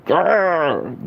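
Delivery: high rough growl at the trolls; playful growl. Acted. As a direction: snarl at the negativity.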